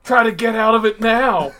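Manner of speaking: loud, high hollered quote; mock shout. Wrestler taunting an opponent in a hold, mock shout. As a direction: yell it out like a wrestler mid-hold. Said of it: "hear her say that in the most unflattering voice".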